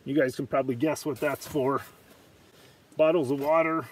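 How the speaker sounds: normal talking voice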